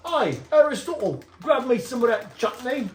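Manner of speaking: a cheeky Cockney-ish British call; mock British accent. Uncle calling nephew in mock British accent. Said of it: "that sounds like a British word".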